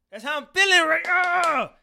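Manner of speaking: hyped shout. Shown as a demonstration. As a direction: yell it out hyped. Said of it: "repeated hyped".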